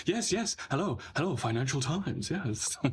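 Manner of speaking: high, eager, flustered yes-man; eager MP answering. Pretend. MP eagerly picking up the Financial Times call. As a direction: jump at the call like a flattered MP. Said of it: "it'll be like".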